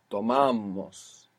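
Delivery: "tomamos" drawn out slowly, stress on MA; stress on second-last syllable. Shown as a demonstration. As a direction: lean long on the middle of "tomamos": to-MA-mos. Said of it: "is going on the second last syllable".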